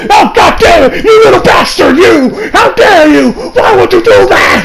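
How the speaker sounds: hammed-up furious announcer yelling at a betrayal; J R impression. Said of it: "my J R impression kinda sucks".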